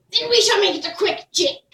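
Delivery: high and loud, merry; cheerful old woman setting the dance. Acted.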